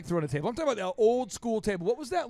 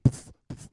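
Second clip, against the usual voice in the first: movie punch sound; 7 dB quieter than usual. Shown as a demonstration. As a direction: mimic a Rocky punch hit. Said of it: "you watch a Rocky, it's".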